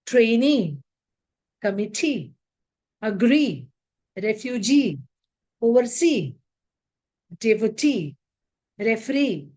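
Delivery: Trainee, refugee, referee, each with a stressed final EE; stress on last syllable. Shown as a demonstration. Teacher drilling double E words, stress on the last syllable. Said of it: "take stress on the last syllable".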